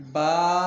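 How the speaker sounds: با held long and loud; stretched با. Shown as a demonstration. Teacher models the letter, با loud with long aa. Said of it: "ایک الف کھینچ کر پڑھیں گے".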